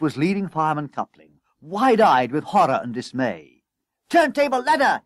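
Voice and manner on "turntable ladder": urgent high-pitched shout; loud, horrified yell down the station. A narrator's reading. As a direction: raise the alarm at full voice. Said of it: "he shouted as he raced".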